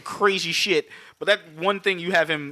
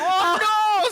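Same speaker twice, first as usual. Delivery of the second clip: mock yelp. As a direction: squeal as the crashing leprechaun. Leprechaun crash retold.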